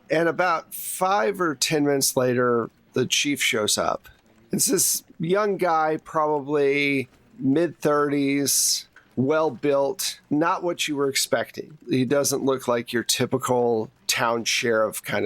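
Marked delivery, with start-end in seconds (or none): none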